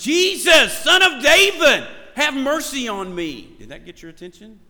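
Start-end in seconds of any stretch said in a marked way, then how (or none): 0.0-3.6 bellowed cry for mercy, much higher and louder; top-of-the-lungs shout. Acted. Blind man shouting to Jesus as he passes by, at the top of his lungs. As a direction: yell it out loud to be heard over a crowd. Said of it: "and he shouts, top of his lungs".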